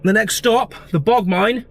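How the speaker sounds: high, loud announcement of the route; loud, high-pitched road-trip kickoff; upbeat calling out. Real, not acted. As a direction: call out the stops with gusto. Setting off, calling out the stops.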